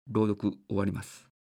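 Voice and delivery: ordinary talk, nothing marked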